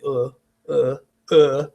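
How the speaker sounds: low, lazy "uh, uh, uh"; lazy schwa grunts. Shown as a demonstration. Teacher demonstrates the lazy schwa sound. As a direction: let the vowel sag, lazy and low. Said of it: "kinda lazy".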